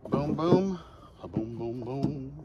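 ordinary talk

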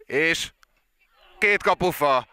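rising excited cry at the double post hit; excited hungarian sports commentary. Real, not acted. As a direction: call the near miss with a jolt. Live goal commentary, near miss.

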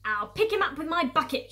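high, loud farmer's boast; shouted threat. Acted. Farmer Bunce hunting the fox, shouting. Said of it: "shouted Bunce".

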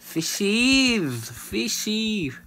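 high, drawn-out sing-out to the fish; playful calling. Pretend. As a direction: call the little creatures in a squeal. Calling out to fish while hunting.

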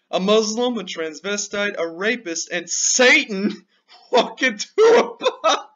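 laughing through a joke setup. Real, not acted. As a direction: crack up on the punchline. Joke told while laughing.

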